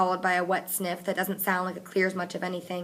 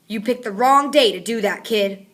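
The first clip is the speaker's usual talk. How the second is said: threat; 3 semitones higher, 9 dB louder than usual. A narrator's reading. Fatigue pinning Connor to wall.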